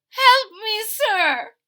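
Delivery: high, loud pleading cry; high squeaky little man pleading; tiny leprechaun crying out. A narrator's reading. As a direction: beg for help in a tiny high voice. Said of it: "cried the little man".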